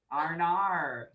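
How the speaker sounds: high, soft Australian "R and R" for "oh no"; Australian accent. Shown as a demonstration. Urging a friend to try it, "R and R" in Australian accent. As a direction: put on an Australian "oh no" as "R and R". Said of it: "Don't ask me to do Australia".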